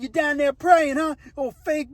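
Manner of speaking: high-pitched acted jeering; mock taunting. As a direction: needle him like a cellblock bully. Acts out inmate taunting a praying rival.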